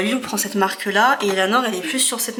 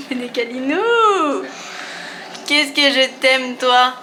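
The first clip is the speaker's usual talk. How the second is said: tender; 3 semitones higher, much slower than usual. Real, not acted. Mother cuddling her child.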